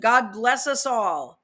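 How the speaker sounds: slow, earnest sign-off; mock solemn reading. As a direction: read the blessing slowly and gravely. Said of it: "Who wrote this?".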